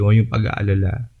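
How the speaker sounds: normal talking voice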